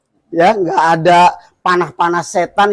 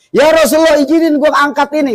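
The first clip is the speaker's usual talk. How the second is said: angry angel; 9 semitones higher than usual. Retold or imitated. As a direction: voice an exasperated angel begging leave to strike. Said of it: "kalau bahasa kitanya".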